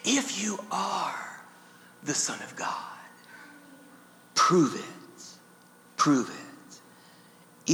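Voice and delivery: slow, hushed tempter's challenge; slithering tempter. Acted. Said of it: "with his slithering question".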